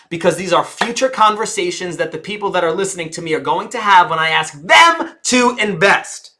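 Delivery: ordinary delivery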